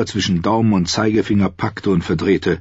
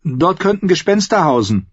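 put-on higher, girlish worried voice; young Arya; 11 semitones higher than usual. Girl's voice, uneasy. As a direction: Arya urges the Hound to stay out, worried.

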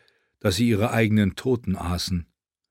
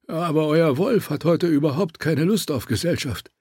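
high-pitched guard voice; Volke der Floh; 6 semitones higher than usual. A narrator's reading. Guard complaining to his lord about the wolf, high character voice. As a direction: put on a higher voice for the small guard. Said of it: "antwortete Volke der Floh".

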